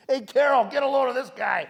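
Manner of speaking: hammed-up mocking shout to Carol; mocking call-out. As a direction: laugh at the kid while shouting to a coworker. Buyer mocks him to coworker.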